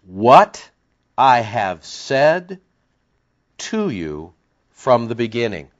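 very slow, word by word; slow dictation. Shown as a demonstration. Preacher dictating his translation for the congregation, very slow. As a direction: spell it out so they can write it. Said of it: "I'll give it to you one more time".

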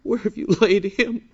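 high-pitched, mock-sympathetic asking; mistakenly tender question. Shown as a demonstration. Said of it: "don't think that Jesus turned to one of them and said".

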